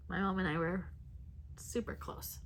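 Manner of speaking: fighting back tears about mom; getting emotional. Real, not acted. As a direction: voice dropping to a near whisper, emotional. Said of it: "Sorry, I'm getting emotional".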